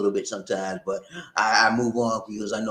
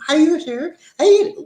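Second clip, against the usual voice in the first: very high, loud put-on voice; character voice; 9 dB louder than usual. Shown as a demonstration. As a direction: squeal the greeting as a silly character. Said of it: "a bunch of different voices".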